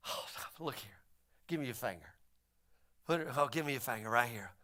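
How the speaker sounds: hushed and kind, Jesus to Thomas; gentle Jesus. Retold or imitated. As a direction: coax him close, very softly. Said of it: "the kind of gentle Jesus".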